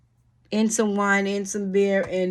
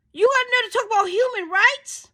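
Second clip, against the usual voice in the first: much higher, pointed question; indignant challenge. Real, not acted. Challenging lawmakers on human rights, indignant. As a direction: throw it back at them. Rant continues.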